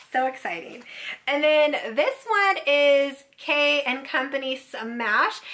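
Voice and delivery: ordinary talk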